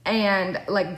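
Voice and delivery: ordinary talk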